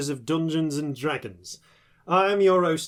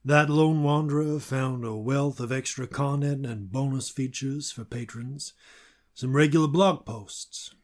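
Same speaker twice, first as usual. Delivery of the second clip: cowboy narrator ad; 2 semitones lower than usual. Acted. Host in a Western storyteller voice reading the Patreon ad. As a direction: spin a lone-wanderer tale as a frontier local.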